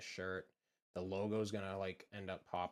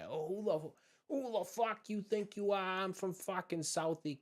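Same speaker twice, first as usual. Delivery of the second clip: high, loud, hammed-up Southie challenge; mock a South Boston hothead picking a fight; Southie tough guy; 11 semitones higher, 5 dB louder than usual. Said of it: "baddest fucking dude in Southie".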